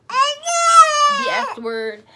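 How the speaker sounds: slow wailing shout through a contraction; cursing in pain. Retold or imitated. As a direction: stretch it out like a scream in labor. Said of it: "I was just saying, like".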